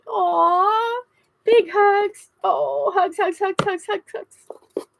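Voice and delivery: high, slow, choked with emotion; holding back happy crying; teary thanks to viewers; happy tears. Real, not acted. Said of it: "I'm not gonna cry, but I'm so happy".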